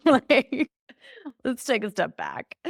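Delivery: laughing, high and light. Retold or imitated. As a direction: laugh it off gently. Coaching client, laughing.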